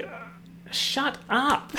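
high, put-on exasperation; groan, then a high, drawn-out retort; exasperated retort. Ranting back at accent critics.

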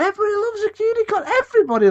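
high, fast gushing; gushing enthusiasm. Real, not acted. Arguing everybody loves a unicorn, gushing. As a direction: hype it up in a high, rushed voice.

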